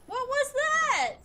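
high, loud, slow shaken question; real fright; frightened. Real, not acted. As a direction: gasp it out after a scare. Jump scare.

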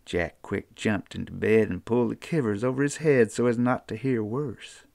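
unhurried Appalachian folk-tale telling; storytelling narration. A narrator's reading. Storyteller reading a children's tale to listeners, easy and folksy. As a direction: spin the yarn like a mountain storyteller.

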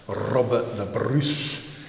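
Robert the Bruce in a Scottish accent with a rolled r; slow, loud Scottish accent. Shown as a demonstration. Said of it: "the Scottish accent may well".

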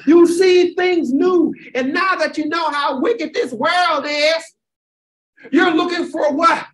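high, shouting preacher cadence; fervent call-and-response sermon; preaching at full force. Real, not acted. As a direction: lift the congregation with every line.

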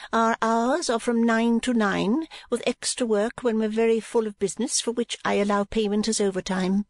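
high, measured employer's voice; businesslike madam. A narrator's reading. Madam giving working hours to new girl, high businesslike voice. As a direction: state the terms in a high madam's voice.

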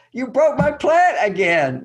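loud, high, slow amused complaint; light amused scolding; laughing it off. Shown as a demonstration. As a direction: laugh at it while you say it. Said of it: "imagine you could actually laugh at it".

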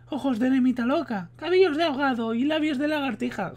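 high put-on female voice; voz de mujer. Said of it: "estoy hasta los huevos de poner la voz de mujer". (hombre imitando voz de mujer)

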